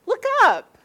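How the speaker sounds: high, loud call to look; mother's sharp high call. Retold or imitated. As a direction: punch it out, high and urgent. Retells mother calling out.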